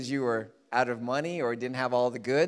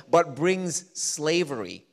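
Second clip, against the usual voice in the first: normal talking voice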